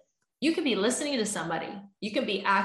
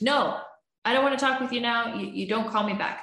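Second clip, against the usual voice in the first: upset refusal; 4 semitones higher than usual. Retold or imitated. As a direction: shut them down, still hurt. Partner refuses to talk.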